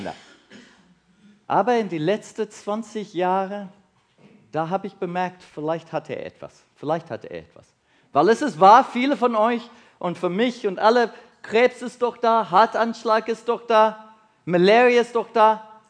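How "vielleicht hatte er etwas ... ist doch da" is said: usual speaking voice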